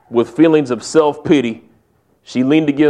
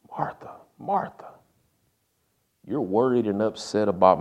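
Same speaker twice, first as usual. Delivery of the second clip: softer, tender reminder; gentle and compassionate; 3 semitones lower, 6 dB quieter than usual. Retold or imitated. Jesus gently answering worried Martha, soft and tender. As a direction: speak softly, as if comforting a friend. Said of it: "Gently, compassionately, he says".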